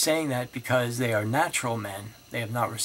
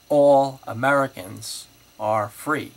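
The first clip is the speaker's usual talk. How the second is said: "All" lifted and louder, said slowly; example stress; 9 dB louder, slower than usual. Shown as a demonstration. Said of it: "I can say, for example".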